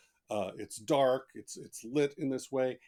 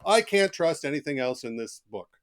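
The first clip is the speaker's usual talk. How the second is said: higher and louder, a mock indignant nitpicker; mock reviewer rant; 6 semitones higher, 7 dB louder than usual. Author mocking an angry Amazon reviewer, louder and higher. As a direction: sneer it like a petty online critic. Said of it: "start his review on Amazon saying".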